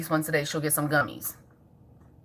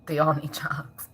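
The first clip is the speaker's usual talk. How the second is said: breathy whispered joke; whispered aside; 5 semitones lower, 5 dB quieter, slower than usual. Real, not acted. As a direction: whisper it like a secret. Aside about kids needing jobs.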